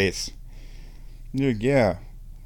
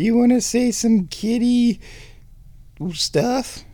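high, coaxing kitty voice; 12 semitones higher, slower than usual. Real, not acted. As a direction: coax a cat in a high voice. Coaxing the cat to speak.

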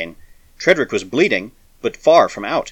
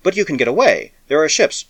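stubborn; 6 semitones higher, 4 dB louder than usual. A narrator's reading. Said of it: "She said stubbornly".

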